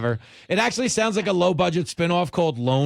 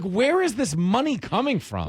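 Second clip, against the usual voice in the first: mock bafflement; 7 semitones higher than usual. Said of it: "I'm like".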